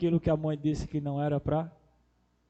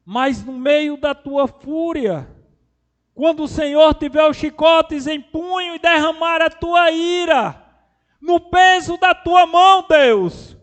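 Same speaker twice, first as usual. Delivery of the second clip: loud, high, impassioned cry to God; full-force preaching; 12 semitones higher, 13 dB louder, slower than usual. Real, not acted. Preacher pleading with God over his wrath, full-force preaching. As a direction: thunder the plea, stretching it out.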